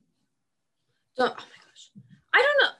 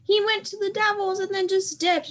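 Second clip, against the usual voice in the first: high mock fan voice; 11 semitones higher than usual. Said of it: "they're like".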